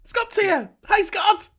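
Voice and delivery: high, loud happy hello; excited greeting. Real, not acted. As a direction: cheer as a friend arrives. Greeting arriving guest loudly.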